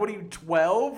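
loud, incredulous laughing jibe; laughing tease. Retold or imitated. Host ribs guest over big girls, laughing tease.

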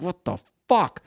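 high, exasperated muttered disbelief; steaming. Retold or imitated. Comic sitting through a star's set, retold seething. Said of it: "just steaming".

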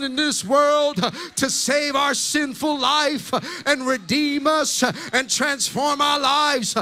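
loud, rising preacher's call, ending in a shout; full-force preaching. Acted. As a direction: preach it, building to the final word. Second preaching climax over music.

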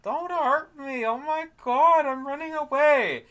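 high-pitched hammed-up damsel panic; mock-frightened falsetto plea; mock coy. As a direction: squeal it like a fake scaredy-cat. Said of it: "being a little bit coy".

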